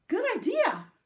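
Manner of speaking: high, loud happy agreement; eager agreement. A narrator's reading. Mother and father agreeing together, high and bright. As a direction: chime in with delight.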